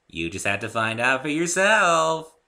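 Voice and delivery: high, loud slow teasing Pinkie; playful secret-keeping tease. A narrator's reading. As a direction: draw it out coyly. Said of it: "said Pinkie teasingly".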